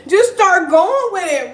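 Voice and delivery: high, loud words through a laugh. Real, not acted. Urging viewers to go live, laughing. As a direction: push the advice out mid-laugh. Laughs while giving advice.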